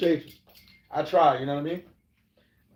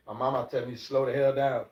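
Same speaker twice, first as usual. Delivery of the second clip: normal talking voice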